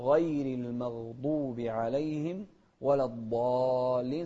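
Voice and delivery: loud slow recitation, الضالين with a long held aa; six-count madd. Shown as a demonstration. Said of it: "ست حركات". (مد الضالين ست حركات)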